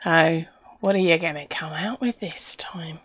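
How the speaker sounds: soft unvoiced aside to the players; whisper. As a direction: whisper it like a teasing secret. Teasing aside to viewers.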